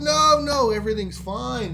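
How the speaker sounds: high, insistent brush-off; breezy dismissal. Retold or imitated. Show-off to worried mates, dismissive reassurance. Wado brushing off friends' worry.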